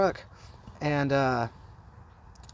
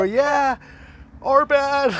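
begging apology; 9 semitones higher, 4 dB louder, much slower than usual. Retold or imitated. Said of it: "we're, like, begging".

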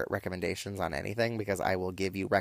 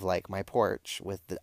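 ordinary talk

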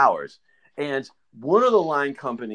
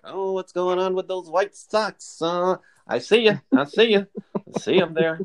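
gotcha call-out; 5 semitones higher than usual. Retold or imitated. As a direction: stretch it out like you just caught them. Mimicking sock-spotting superior catching someone.

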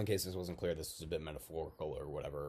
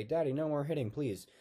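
put-on high, louder child begging; acted child's plea; pleading child; 9 semitones higher, 5 dB louder than usual. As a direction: beg like a frightened little kid. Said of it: "and I would say".

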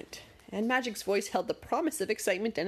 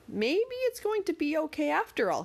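hopeful thought; 9 semitones higher than usual. A narrator's reading. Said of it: "he thought".

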